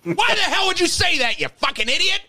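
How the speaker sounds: high, loud mock tirade at himself; mock self-yelling. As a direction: yell at yourself like a fed-up critic. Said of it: "I'm yelling at myself".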